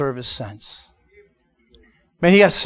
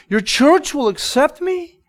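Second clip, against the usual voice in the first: disbelieving question; 9 semitones higher than usual. Retold or imitated. Crying man asks, incredulous.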